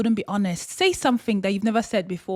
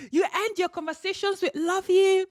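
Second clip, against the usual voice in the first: laughing, higher, giddy retelling; giddy delight; 7 semitones higher than usual. Shown as a demonstration. Speaker delighting in friends saying love you, giddy laugh. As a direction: gush it through a laugh. Retold with laugh, high and gushing.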